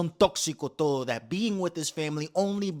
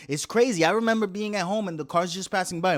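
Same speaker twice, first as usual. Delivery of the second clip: ordinary delivery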